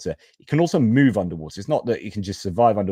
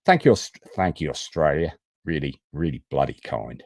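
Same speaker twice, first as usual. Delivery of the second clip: put-on playful Aussie accent, dropping lower by the end; mock Australian accent; 5 semitones lower than usual. Host thanking Australia for its support, mock Australian accent. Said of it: "it's cultural appropriation time".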